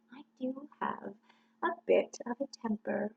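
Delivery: unvoiced confiding whisper. Confessing the temper to the crowd in a whisper. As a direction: let it slip in a hushed whisper.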